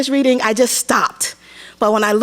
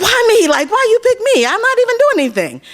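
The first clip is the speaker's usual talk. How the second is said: high, fast incredulous protest; can't believe they chose you; stunned teen disbelief; baffled why me; 12 semitones higher than usual. Retold or imitated. Baffled at being picked.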